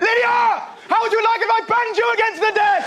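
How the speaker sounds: high, loud, slow shouted threat at the pupil; teacher's outburst. Retold or imitated. Teacher shouting at Lydia bashing her calculator, loud slow yell. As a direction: yell it at the girl like a fed-up teacher. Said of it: "our teacher went".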